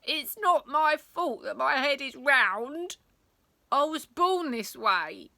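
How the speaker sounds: put-on slow pleading child voice; protesting boy. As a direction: plead your innocence like a wronged kid. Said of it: "protested Roland".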